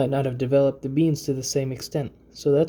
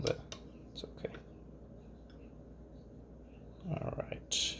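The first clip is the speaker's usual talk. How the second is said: whispering; 15 dB quieter than usual. Real, not acted. Quiet asides while scooping crema.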